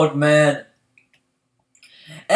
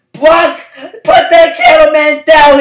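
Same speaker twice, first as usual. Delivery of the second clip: mock crowd demand; 9 semitones higher, 6 dB louder, slower than usual. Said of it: "Some people were like".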